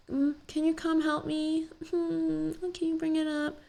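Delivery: high, sweet, helpless little voice, pitched well up; mock helpless girl. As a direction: play a coy helpless girl asking a guy to carry it for her. Mocking women asking guys for help.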